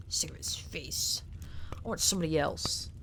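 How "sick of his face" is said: slow, soft voiceless whisper. Real, not acted. Streamer ending the rant in a slow whisper. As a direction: mutter it under your breath.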